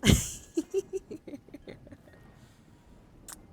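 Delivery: loud breathy burst of laughter. Real, not acted. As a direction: let out a loud airy laugh. Laughing after thanking viewers.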